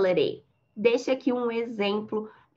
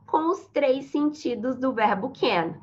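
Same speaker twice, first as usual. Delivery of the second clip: ordinary talk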